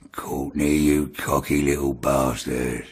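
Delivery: low, slow menacing taunt; voice from hell. Retold or imitated. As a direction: drag it out like a hellish threat. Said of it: "it just sounded like it came from hell".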